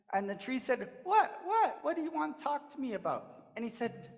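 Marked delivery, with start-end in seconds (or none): 1.0-3.5 high, eager questioning; eager curiosity. Acted. The tree asks Coyote eagerly what he means. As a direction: ask eagerly, leaning in.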